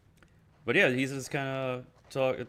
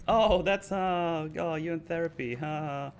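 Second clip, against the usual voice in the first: mocking Dina; 7 semitones higher, slower than usual. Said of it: "makes fun of him".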